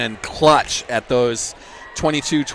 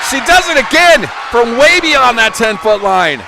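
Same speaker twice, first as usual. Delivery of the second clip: huge high shouted call; excited play-by-play shout; 9 semitones higher, 9 dB louder than usual. Real, not acted. Announcer calls a repeat point from way back, shouting excitedly. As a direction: belt out the big play, voice soaring.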